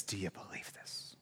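soft whispered question; intimate whisper. Acted. Pastor asks the congregation a personal question, whispered. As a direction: lean in and ask it quietly.

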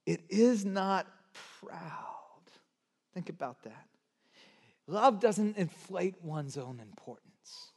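ordinary talk, nothing marked

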